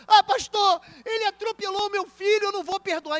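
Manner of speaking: high, loud, bitter refusal; angry parent. Acted. Grieving parent refuses to forgive the driver, shouting high. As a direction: shout it like a wronged mother.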